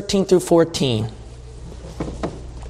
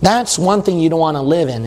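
ordinary talk, nothing marked

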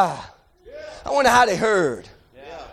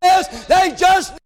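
high, driving preacher's cadence; fervent preaching; 6 semitones higher than usual. Acted. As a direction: build to a pulpit shout. Preaches the blind men's cry.

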